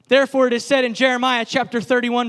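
normal talking voice

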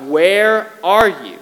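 loud, slow, drawn-out call; crying out. Acted. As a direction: cry out to a God who seems gone. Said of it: "And David cries out".

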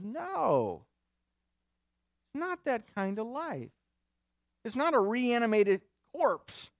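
insistent, spaced-out denial; emphatic rebuttal. Acted. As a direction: firmly set them straight, pitch lifted. Acting Paul's answer to the mocker.

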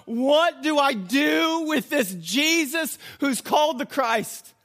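high, loud, slowed-down question; preacher's shouted challenge. Acted. Preacher makes listeners ask themselves, shouted challenge. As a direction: hurl the question out word by word.

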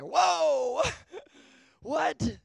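loud, high startled whoa with a laugh; a startled yelp that breaks into a laugh; freaking out. Retold or imitated. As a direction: jump back in shock at a ghost. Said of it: "He was freaking out".

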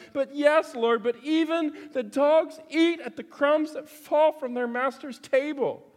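high, insistent acted plea; persistent woman's reply. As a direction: plead back as the undeterred mother. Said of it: "she responds once again".

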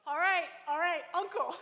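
high, giggling give-up; laughing surrender. Retold or imitated. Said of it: "And I was like".

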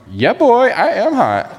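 high mock brag; a lightly voiced sassy woman bragging; sassy self-praise. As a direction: play the cocky girl. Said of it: "I'll never do that impersonation again".

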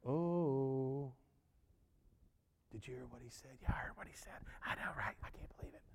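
neighbors whispering gossip low and breathy; gossipy whisper. Retold or imitated. Neighbors gossip about the healed man in whispers. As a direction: hush it like neighbors trading a scandal. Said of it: "the neighbors all went".

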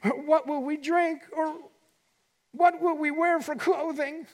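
high, fretful worried voice; anxious worrier. Retold or imitated. Preacher mimicking a fretting worrier, high and anxious. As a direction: wring your hands in mock worry. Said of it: "Imagine somebody wringing their hands".

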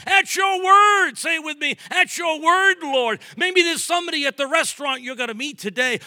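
loud, high, shouted preacher's climax; preaching at full force. Acted. Pastor urging the congregation at the climax, preaching at full force. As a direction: bring the whole church to its feet.

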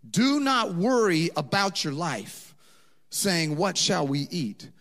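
loud preaching over music; full-force preaching. Acted. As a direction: preach it out with power.

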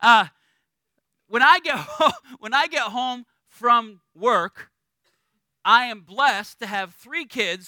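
usual speaking voice

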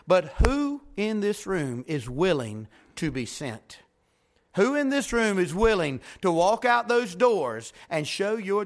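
fired-up pulpit preaching; preaching at full force. Acted. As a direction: drive the story home to the congregation. Altar-call preaching climax.

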